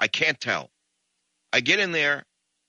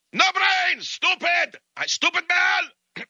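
put-on high shrieking yell in a Russian accent, dragging out the last words; screaming Russian; 3 dB louder, much slower than usual. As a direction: howl it like an enraged Russian. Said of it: "a crazed Russian".

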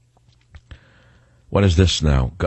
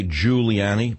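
"Giuliani" with the first syllable leaned on and pitched up; stress on first syllable; much slower than usual. Retold or imitated. Host mimicking how the speaker says "Giuliani", first syllable stressed. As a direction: hit the opening "Giu" of "Giuliani". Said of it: "with an emphasis on the first syllable".

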